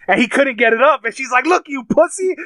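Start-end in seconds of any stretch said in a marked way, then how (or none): 1.4-2.3 retold screaming. Daughter taunting the man attacking her, mock screaming. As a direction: imitate a screamed taunt in a raised pitch. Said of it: "Starts screaming at him".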